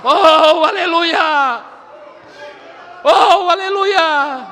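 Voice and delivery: high, loud cries of worship; fervent shouted hallelujahs and glossolalia; ecstatic praise shout. Real, not acted. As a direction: burst out in praise, spilling into tongues.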